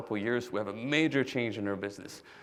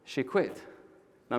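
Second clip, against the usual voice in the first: normal talking voice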